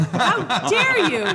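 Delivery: high, drawn-out "how dare you" outrage; mock indignation. Host to co-host who doubts her accent, mock indignant. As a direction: play up being offended, laughter underneath.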